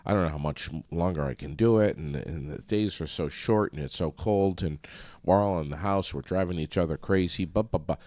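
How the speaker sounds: ordinary delivery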